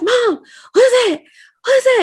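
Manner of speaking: high frightened cry; panicked, high-pitched question; scared child. Retold or imitated. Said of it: "I was like".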